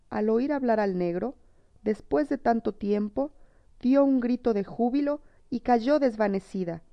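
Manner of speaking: usual speaking voice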